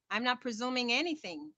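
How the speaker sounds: ordinary talk, nothing marked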